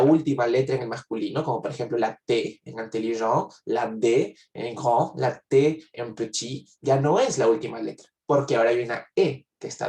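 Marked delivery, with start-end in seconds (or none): none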